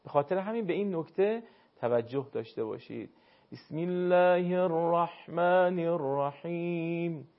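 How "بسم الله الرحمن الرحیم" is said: slow chanted الرحمن الرحیم, vowels after ح kept thin; throaty ح in الرحمن without heavy, rounded vowel; light ḥā. Shown as a demonstration. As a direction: draw out the recitation, keep ح in الرحیم light. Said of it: "الف مدی شما درشت و پرحجم تلفظ نخواهد".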